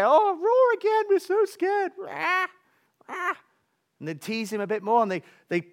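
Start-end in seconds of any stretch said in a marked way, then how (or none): 0.0-1.9 high, loud jeering taunt; mocking hyenas. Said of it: "they tease him and they say". 2.0-3.3 mock roar.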